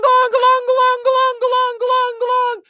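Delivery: very high, loud ringing glongs; mock church bells. Annoyed at bells during business hours, a high loud bell mimicry.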